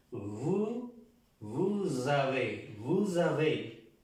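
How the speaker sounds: vous avez, slow, S linked as a Z; slow liaison drill. Shown as a demonstration. Teacher modelling for students, slow vous avez with a Z. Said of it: "se pronuncia como una Z".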